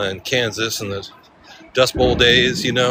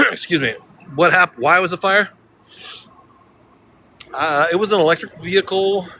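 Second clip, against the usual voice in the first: attempted Louisiana accent; 6 semitones higher than usual. Shown as a demonstration. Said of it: "It sounds Texas".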